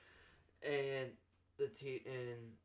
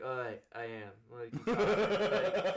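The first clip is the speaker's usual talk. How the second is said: laughing; 8 dB louder, slower than usual. Retold or imitated. Mock confession to teacher, laughing.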